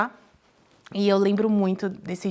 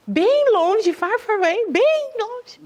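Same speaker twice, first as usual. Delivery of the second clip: far far away; 11 semitones higher, 3 dB louder, much slower than usual. Acted. Exaggerating how far the church was.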